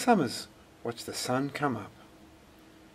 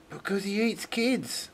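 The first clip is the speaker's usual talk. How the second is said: put-on higher little girl voice; child's voice; 11 semitones higher than usual. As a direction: say it like a scared small girl. Little girl answers mum.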